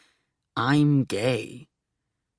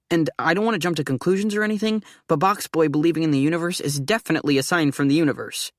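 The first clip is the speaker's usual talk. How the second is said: rushed excited; 3 semitones higher, faster than usual. Acted.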